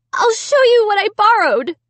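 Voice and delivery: high, loud insistence; urgent insisting. A narrator's reading. Amy proving her innocence, high and loud. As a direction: push it out urgently.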